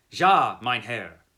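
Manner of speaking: loud, slow, emphatic German reply; firm "Ja, mein Herr", said slowly. Acted. Coachman assuring his master, loud and emphatic. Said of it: "answered with an emphatic".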